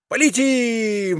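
high, drawn-out eager cry; exclaiming. A narrator's reading. Mishka agrees to fly on, exclaiming high. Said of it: "воскликнул Мишка".

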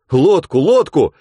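loud, slow urgent call; urgent shout. Acted. People on the shore shout for a boat.

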